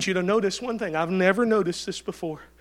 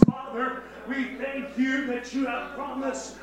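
softer, higher, earnest prayer voice; 5 semitones higher, 5 dB quieter than usual. Acted. Pastor leading the church in closing prayer, soft earnest longing. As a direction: pray it softly, longing.

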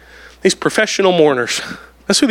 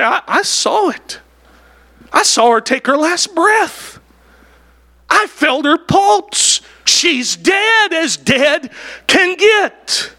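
insistent mourner; 8 semitones higher, slower than usual. Acted. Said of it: "You almost hear one of them say".

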